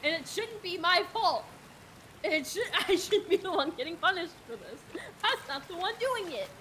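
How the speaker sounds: high, loud, exasperated self-defence; indignant protest. Real, not acted. Protesting that friend is to blame.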